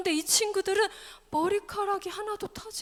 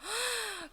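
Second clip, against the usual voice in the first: sharp audible intake of breath; storyteller's gasp; 6 semitones higher, 6 dB quieter than usual. Pretend. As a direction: gasp for the children. Building suspense before king's reaction. (헉 하고 숨 들이쉬기)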